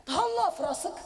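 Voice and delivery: mock threat, high and loud; a hint of a threatening Moroccan man; menacing Moroccan phrase. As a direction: say it like you're about to hit someone. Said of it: "on dirait qu'ils vont te frapper".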